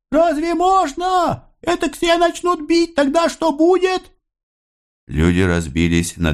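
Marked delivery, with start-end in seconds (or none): 0.1-4.1 very high-pitched voice of protest; crying, pleading crowd. A narrator's reading. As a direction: cry out in alarm, remonstrating with the officer. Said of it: "кричала и уговаривала его". (высокий, тревожный протестующий крик)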